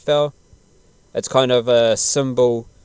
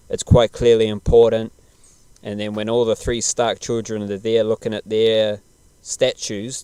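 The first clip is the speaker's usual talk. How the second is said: usual speaking voice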